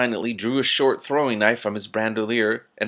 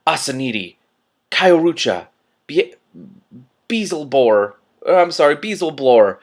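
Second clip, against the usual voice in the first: hammed-up louder magic words; mock incantation; 4 semitones higher, 7 dB louder than usual. A narrator's reading. Rincewind faking a spell at the swordsman, hammed-up incantation. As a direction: bluff a spell at full voice. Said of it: "wizardly pose".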